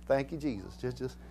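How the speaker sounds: a soft private praise under the breath; quiet aside. Retold or imitated. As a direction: tune out and murmur a prayer. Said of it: "I'm just".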